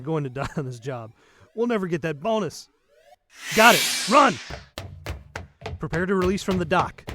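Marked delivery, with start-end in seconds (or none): 3.5-4.3 shout. Acted. Fleeing the ship.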